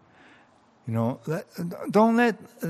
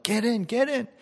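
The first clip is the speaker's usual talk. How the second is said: high, called-out urging; yelled call; 9 semitones higher than usual. Retold or imitated. Canoe rescuer calling to the stranded man, high call. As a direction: call him to get in. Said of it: "came and yelled out".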